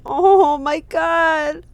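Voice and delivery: very slow, high gasp of horror; shocked oh my god. Real, not acted. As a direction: drag it out in dismay. Reaction to the scene.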